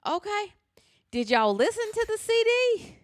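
high, slow mock-innocent asking; incredulous church-meeting question; retold question. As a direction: play dumb with a sweet high question. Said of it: "I said".

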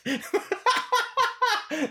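high, breathless laugh; real laughing fit. Real, not acted. Fan amused by his own rant, high laughter. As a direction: burst out laughing.

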